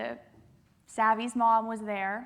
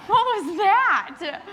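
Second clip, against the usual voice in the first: teasing crowd; 9 semitones higher, 11 dB louder, much slower than usual. Real, not acted. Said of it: "teasing the quiet crowd".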